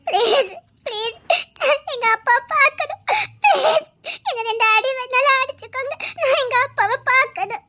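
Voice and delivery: sobbing, desperate plea; tearful begging. Acted. Girl begging to see her father, sobbing. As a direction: plead through tears, stretching the please. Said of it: "உங்க கெஞ்சி கேக்குறேன்".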